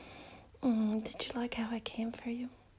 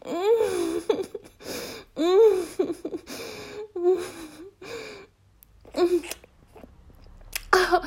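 loud moan; 7 semitones higher, 10 dB louder, much slower than usual. Acted. Climax moans.

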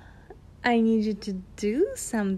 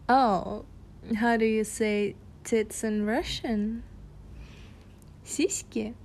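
normal talking voice

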